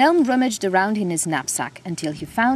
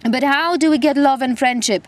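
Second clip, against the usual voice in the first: chattering teeth; 6 semitones higher, 4 dB louder than usual. Acted. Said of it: "through chattering teeth".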